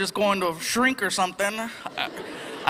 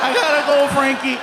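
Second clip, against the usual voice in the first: hammed-up cry; 4 semitones higher, 5 dB louder than usual. Dramatic goodbye shouted.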